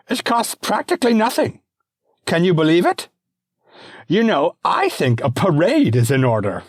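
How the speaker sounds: put-on very high, loud, childish Bravo; squealing imperial delight; gleeful emperor. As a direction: cheer like a spoiled boy-king. Emperor addresses the Senate.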